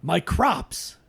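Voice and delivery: Al Pacino voice. Shown as a demonstration. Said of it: "Al Pacino".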